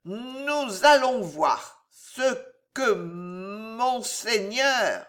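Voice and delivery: slow, stretched tipsy threat; labored drunken delivery; drunk Gillot. Acted. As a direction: trail out the words drunkenly.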